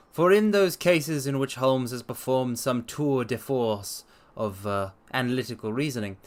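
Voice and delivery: measured storyteller's reading voice; story narration. Acted. Streamer reading Holmes aloud to his chat, dramatic narration.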